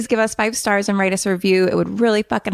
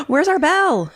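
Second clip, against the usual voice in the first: gasping surprise; 9 semitones higher than usual. Real, not acted. Gasping, asking for the bell.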